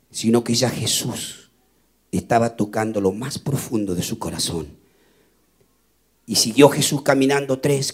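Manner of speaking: tender sermon narration. Acted. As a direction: draw them into the moment gently. Tender turn to Zacchaeus weeping.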